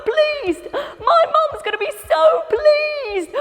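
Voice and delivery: high, breathless glee; thrilled to please mum; high gleeful repeat; excited. Acted. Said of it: "ran all the way home excited".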